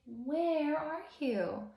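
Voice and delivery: high, slow, drawn-out calling; searching character call. Acted. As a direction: call out searching for someone hiding. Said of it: "He'll say".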